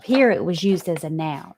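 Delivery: ordinary delivery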